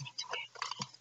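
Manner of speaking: hushed aside; whispering. Real, not acted. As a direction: whisper it to yourself. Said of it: "whispered aside".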